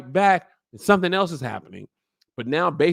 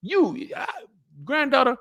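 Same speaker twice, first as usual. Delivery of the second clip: much higher, hyped; high excited giveaway; 9 semitones higher than usual. Retold or imitated. Old lord gifting Driftmark to granddaughter, high and hyped. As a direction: gush as he hands it over. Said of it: "he said".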